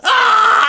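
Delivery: very high, loud shriek; hammed-up burglar scream; startled shriek; mock scream. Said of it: "He goes".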